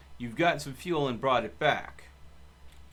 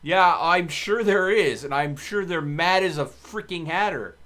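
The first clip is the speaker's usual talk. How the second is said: loud, slow sarcastic jab; loud exasperated scoff; 3 semitones higher, 8 dB louder than usual. Real, not acted. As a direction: scoff loudly at the idea. Mocking a crazy YouTuber idea.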